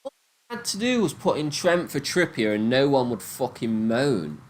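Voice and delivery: ordinary delivery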